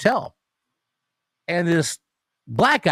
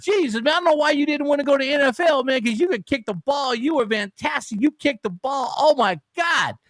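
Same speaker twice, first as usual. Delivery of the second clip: much higher, gushing mock stranger voice; amazed stranger; 12 semitones higher than usual. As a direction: rave up high like a starstruck fan.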